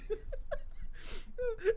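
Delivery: high wheezing laugh; helpless laughter. Real, not acted. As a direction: lose it completely. Laughing at dad story.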